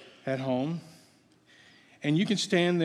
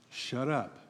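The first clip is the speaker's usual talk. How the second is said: quiet deadpan aside; deadpan joke; 5 semitones lower, 8 dB quieter than usual. Shown as a demonstration. Preacher jokingly telling the congregation to shut up, deadpan. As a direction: drop it flat and soft as a joke. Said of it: "I was joking about the "shut up," part".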